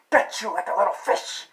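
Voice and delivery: sudden loud menacing burst; hammed-up killer's threat; mock threat. Mock threat to gut viewers.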